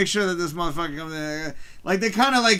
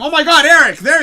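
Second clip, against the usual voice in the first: real high, loud delighted exclamation; happy surprise; 7 semitones higher, 10 dB louder than usual. Real, not acted. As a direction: call out, thrilled to spot a friend. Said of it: "I'm so happy that you're here".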